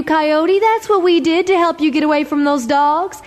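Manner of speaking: high, quick little voice of the eyes; trickster tale voices. Acted. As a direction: chirp it up high and eager. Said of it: "Coyote's eyes said".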